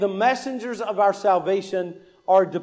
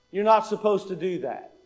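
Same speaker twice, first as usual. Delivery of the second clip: ordinary delivery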